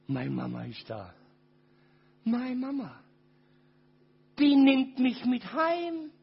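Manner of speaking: tearful hope. Real, not acted. Child's hope for his mother.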